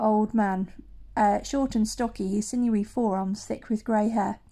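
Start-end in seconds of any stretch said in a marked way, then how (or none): none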